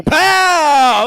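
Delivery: long, high, full-volume "pow!"; shouted "pow". Retold or imitated. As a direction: explode on a stretched-out pow. Sudden reveal in burglary story.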